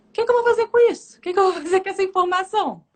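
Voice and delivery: high-pitched, rushed bewilderment; puzzled urgent question. Retold or imitated. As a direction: ask it urgently, acting out bafflement. Encena sua perplexidade diante de Deus. (perplexa, em tom agudo e apressado)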